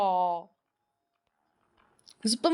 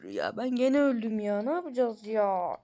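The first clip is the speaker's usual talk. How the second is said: ordinary talk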